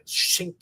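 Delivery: unvoiced-style whispery metallic shing; hushed, sharp "shink" noise; claw sound effect. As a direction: imitate blades sliding out. Imitates Wolverine's claws popping out.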